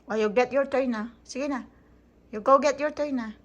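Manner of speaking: normal talking voice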